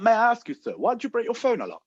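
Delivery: put-on high, quick phone salesman accent; Aaron's accent. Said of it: "I'm not exaggerating this accent".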